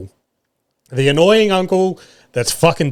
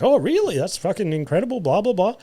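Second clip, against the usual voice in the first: high, mock-impressed gush; gushing lady; 7 semitones higher, 5 dB quieter than usual. A father mocking a lady impressed by a stranger's boast, high gushing. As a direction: fake wide-eyed amazement. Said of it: "she's like".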